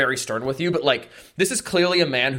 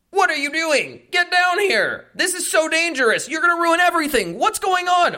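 high-pitched panicked yelping; nervous breakdown. Acted. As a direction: elf frantically scolding, voice shooting way up. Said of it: "like, a nervous breakdown".

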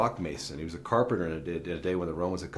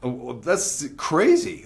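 mock disbelief; 8 semitones higher, 8 dB louder, slower than usual. Dad reacting to son's dating talk.

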